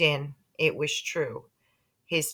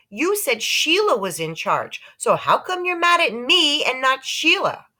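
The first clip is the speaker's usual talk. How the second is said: put-on indignant boy; 9 semitones higher, 5 dB louder than usual. A narrator's reading. Peter argues.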